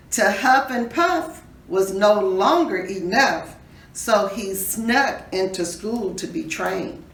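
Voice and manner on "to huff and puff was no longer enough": plain reading voice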